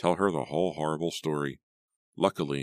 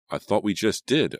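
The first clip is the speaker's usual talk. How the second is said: higher, louder comeback; sharp retort; 6 semitones higher, 6 dB louder than usual. A narrator's reading. Natalie answering Keith's demand to talk, sharp retort. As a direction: fire it straight back at him. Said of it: "I shot back".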